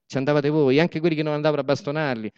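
normal talking voice

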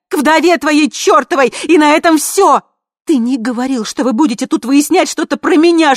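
high, very loud tirade; high-pitched yelling fury; furious screaming. A narrator's reading. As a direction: scream accusations at full voice. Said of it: "заорала Ви".

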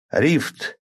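sharp high incantation; spell shout. Acted. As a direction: cry out the spell name. Narrator casts spell aloud.

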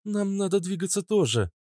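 high-pitched female character; high, put-on woman's voice. A narrator's reading. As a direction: lift the pitch well up for the woman's line.

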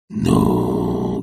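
low, drawn-out exasperation; despairing. A narrator's reading. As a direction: let it sink low in despair. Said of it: "говорил Захар в отчаянии".

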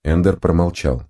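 ordinary delivery

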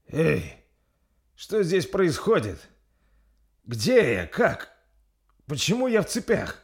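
high-pitched, puzzled questioning; Горд bewildered. Acted. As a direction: wake confused and demand to know what's happening. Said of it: "недоумевая спросил Горд".

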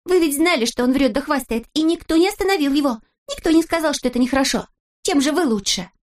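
high-pitched, insistent scolding; indignant exclamation. A narrator's reading. Snezhinka blames boys for not stopping him, indignant exclamation. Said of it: "воскликнула Снежинка".

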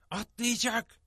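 tense, high-pitched hiss of alarm; urgent hissed warning. A narrator's reading. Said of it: "diye tısladım". (tiz, telaşlı bir tıslamayla uyarı)

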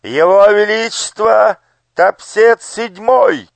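slow, high, ringing herald call; grand entrance announcement. Acted. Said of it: "торжественно объявил".

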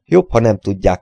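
ordinary delivery, nothing marked

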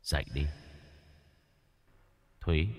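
soft, low, ghostly call to wake her; eerie soft call. Acted. A spirit softly calling Thúy awake in her dream. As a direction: murmur it like a spirit from the dark.

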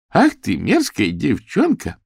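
slow, loud, high-pitched scolding; barked. A narrator's reading. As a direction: bark it out in outrage. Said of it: "рявкнула Дейзи".

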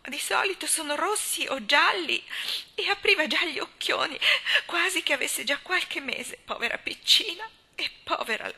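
still sobbing. A narrator's reading. Susan mourning the pale newborn, high tearful lament. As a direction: keep crying as you praise the baby. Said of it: "disse Susan tra i singhiozzi".

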